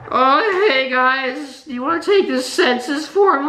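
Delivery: high, sing-song fake politeness; exaggerated sweet asking voice; high mock-polite voice. Exasperated retelling of the same ask.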